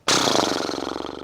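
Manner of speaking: soft unvoiced lip-flapping breath; a soft horse snort through the lips; horse blow. Shown as a demonstration. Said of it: "we make a big horse blow like this".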